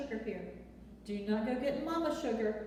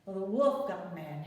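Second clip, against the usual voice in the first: ordinary delivery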